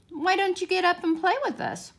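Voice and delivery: put-on high, bright young rabbit voice; a young wild rabbit teasing; high furry rabbit voice. A narrator's reading. Wild rabbit inviting the toy rabbit to play, in a high voice. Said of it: "one of them asked".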